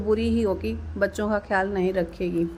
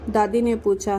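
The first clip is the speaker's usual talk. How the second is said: ordinary delivery, nothing marked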